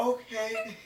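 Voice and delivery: high, soft placating okay; imitating a too-polite stoner; overly polite. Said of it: "way too polite when you're high".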